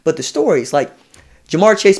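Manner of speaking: usual speaking voice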